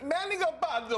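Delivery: mimicking a mispronounced name with a mock Italian flourish; a high, lilting Italian-sounding take on the name. News anchor mocking a colleague's mispronunciation, hammed-up Italian. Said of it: "That was Italian".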